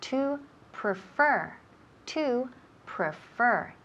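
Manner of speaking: PREFER said slowly, weight on FER; slow model of "prefer", leaning on the last syllable; second-syllable stress. Shown as a demonstration. Teacher showing where the accent falls, slow stressed model. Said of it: "el acento recae en la segunda sílaba".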